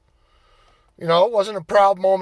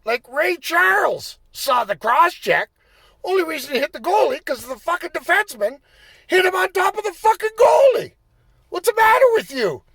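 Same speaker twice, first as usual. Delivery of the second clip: high, loud furious rant; ranting at the ref; 11 semitones higher, 5 dB louder than usual. Retold or imitated. Player yelling at the referee over the bad call, furious. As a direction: tear into the referee. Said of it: "And I'm like".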